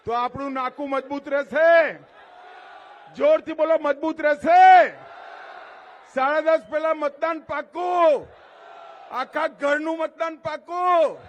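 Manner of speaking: loud, high shouted questions; rousing pledge-taking shout; rally call-and-response. Real, not acted. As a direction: press the crowd for a roaring yes. Demanding pledges from the crowd.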